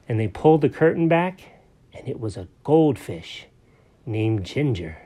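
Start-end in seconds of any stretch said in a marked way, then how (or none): none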